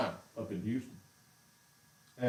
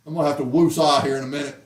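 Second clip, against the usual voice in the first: ordinary talk, nothing marked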